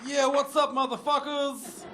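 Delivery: high, loud greeting with a drawn-out end; put-on voice. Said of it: "That's not my real voice".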